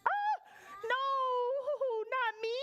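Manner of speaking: very high, pleading little goat; little billy goat. Acted. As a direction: squeal in mock fright as a tiny goat. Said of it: "said the little billy goat".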